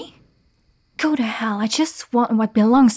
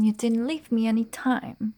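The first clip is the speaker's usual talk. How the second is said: ordinary talk, nothing marked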